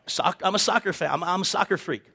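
ordinary talk

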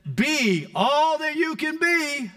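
high, slow mock army slogan; recruitment slogan. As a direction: sell it like a recruiting ad. Recruitment poster slogan quoted.

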